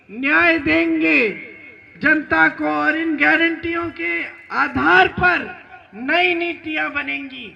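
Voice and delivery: loud, projected political speech; forceful stump speech in measured phrases; rally oratory. Real, not acted. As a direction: project each phrase out to a big crowd. Rally speech building to full projection.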